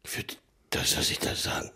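low, soft whisper, cornered; frightened whisper. Acted. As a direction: breathe it out, afraid of being caught. Cornered butcher answers accusation. (geflüsterte, ängstliche Frage)

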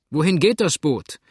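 high, loud shout from shore; calling out. A narrator's reading. Man on the bank questioning the boat, loud call. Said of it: "rief abermals die Stimme vom Ufer".

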